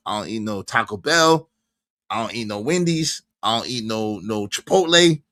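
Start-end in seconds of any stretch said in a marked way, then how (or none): none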